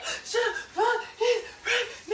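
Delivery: high, slow sobs, word by word; mock hysterical crying. Daughter imitates mom phoning grandma, hysterically crying. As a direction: heave out each syllable in fake sobs. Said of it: "She was hysterically crying".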